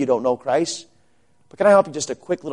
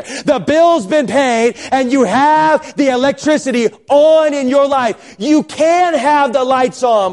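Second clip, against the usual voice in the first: high, emphatic preacher delivery; full-force preaching; 10 semitones higher, 6 dB louder, slower than usual. Acted. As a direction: drive each point home with stretched key words.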